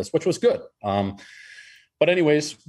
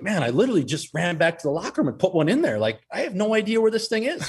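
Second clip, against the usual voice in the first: higher, panicky half-laughing excuse; flustered teammate; 8 semitones higher than usual. Retold or imitated. Teammate explaining the missing pen, flustered. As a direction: scramble to explain. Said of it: "He's like".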